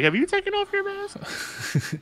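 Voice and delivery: squeaky high-pitched mock question. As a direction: squeak it out like a whiny character. Mock quote of helmet-obsessed character.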